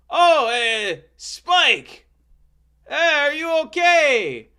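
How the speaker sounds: very high, loud comic Frenchman; exaggerated high-pitched, booming French character; Jacques de Gatineau. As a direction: call out in a hammed-up, drawn-out high voice. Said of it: "And that's how he talked".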